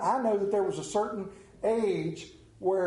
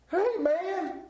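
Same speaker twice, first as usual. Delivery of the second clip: startled Peter; 12 semitones higher than usual. Acted. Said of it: "Peter startled".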